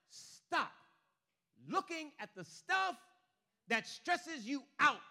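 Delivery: loud, high, slow order; forceful command. Acted. Preacher voicing God cutting through the worry, forceful. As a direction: hammer each word out slowly. God's command voiced over the protests.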